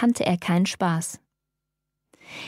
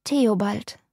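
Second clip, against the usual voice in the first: choked with tears. A narrator's reading. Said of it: "fast erstickt von Tränen".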